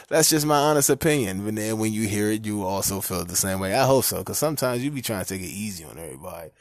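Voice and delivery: normal talking voice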